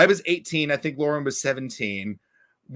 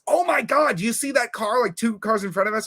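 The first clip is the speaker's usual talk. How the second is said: excited friend; 7 semitones higher than usual. Retold or imitated. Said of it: "and she says".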